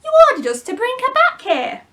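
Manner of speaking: high, loud gruff complaint; bewildered pirate shouting back; pirate yelling. Acted. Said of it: "the pirates yelled".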